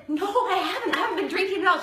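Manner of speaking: startled denial. Retold or imitated. To the police officer, a high startled laughing denial. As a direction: insist you're sober, half laughing.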